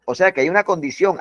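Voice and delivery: usual speaking voice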